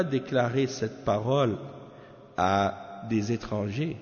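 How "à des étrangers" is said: usual speaking voice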